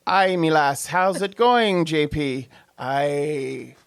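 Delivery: lilting attempt at Northern Irish; Northern Irish accent. Shown as a demonstration. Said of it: "that was my Northern Ireland".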